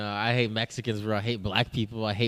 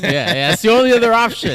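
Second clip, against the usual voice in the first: a louder, higher, mock-agreeing sarcastic quip; real sarcasm, thrown back with a laugh; 10 semitones higher, 13 dB louder than usual. Real, not acted. To the host guessing Mexican next, loud sarcastic agreement.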